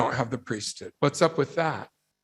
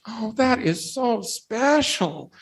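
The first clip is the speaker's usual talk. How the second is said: higher, slow, sugary mock coo; imitating cooing guests; 7 semitones higher, slower than usual. Mocking the congregation at the wedding, sugary coo. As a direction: parody the touched wedding guests. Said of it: "Everybody in the church was like".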